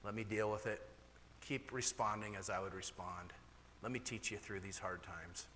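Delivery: softly, low and gentle God speaking. Retold or imitated. God telling the preacher softly to go to the bottom and let him deal with it. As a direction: let it settle, hushed and calm. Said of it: "And God says".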